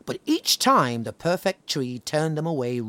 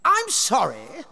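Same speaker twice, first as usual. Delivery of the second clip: vain tree; 12 semitones higher than usual. Acted. The tree refusing animals, haughty and high. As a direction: sniff it out haughtily. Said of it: "said the oak tree".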